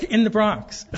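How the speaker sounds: laughing through the words. Real, not acted. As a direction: chuckle at the absurdity while speaking. Laughs at French draft notice.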